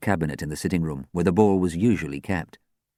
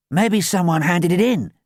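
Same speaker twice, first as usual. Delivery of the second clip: high, louder, hopeful; upbeat, pitched-up helpfulness; bright suggestion; 11 semitones higher, 5 dB louder than usual. A narrator's reading. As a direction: offer the idea brightly. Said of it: "Zac suggested brightly".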